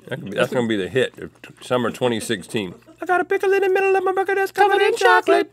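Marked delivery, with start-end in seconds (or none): 3.0-5.5 very high fast squeal; high squeaky voice. Acted. Repeats high voice.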